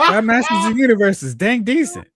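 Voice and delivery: high, loud teasing quote; mock grudging praise. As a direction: tease them with their own words.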